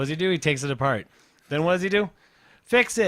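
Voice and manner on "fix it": higher, louder mock child; demanding little kid. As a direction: imitate a child handing over an order. Imitates child demanding help.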